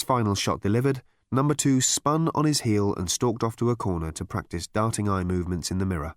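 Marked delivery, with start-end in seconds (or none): none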